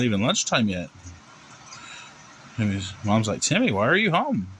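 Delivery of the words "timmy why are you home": lightly voiced mother, high-pitched question; a hint of a puzzled mom; surprised mom. Mom surprised by son's return.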